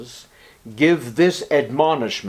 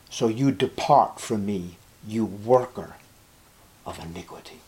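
low, soft verdict fading away; solemn dismissal; 5 semitones lower, 6 dB quieter than usual. Acted. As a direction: send them away quietly, voice sinking to a hush. Jesus dismissing false followers.

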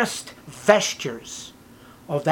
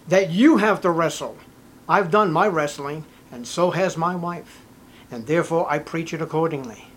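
ordinary delivery

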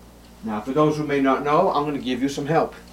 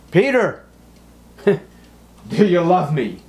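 much higher, louder, slow pressing call; pressing question; 6 semitones higher, 6 dB louder, slower than usual. Acted. Jesus asks Peter a second time, slow and pressing. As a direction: stretch out the question, pressing harder.

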